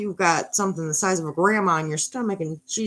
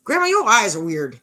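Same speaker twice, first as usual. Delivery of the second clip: Little Red; 3 semitones higher, 6 dB louder than usual. Acted. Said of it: "Little Red, staring at him some more, goes".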